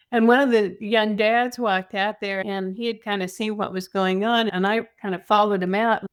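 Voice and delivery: ordinary delivery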